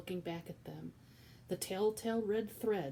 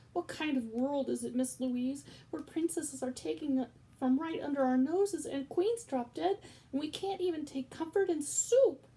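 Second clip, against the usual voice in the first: loud, high despairing cry; distraught outburst; 8 semitones higher, 6 dB louder than usual. Acted. Cook crying out to Louise, loud despair. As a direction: rail at the world.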